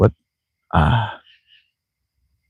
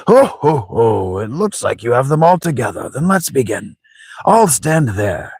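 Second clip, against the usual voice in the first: put-on jolly old man with high swoops; chuckle, then announce the start; theatrical old host, slowing at the end; chuckling old grandfather; 6 semitones higher than usual. Old man gathers the party.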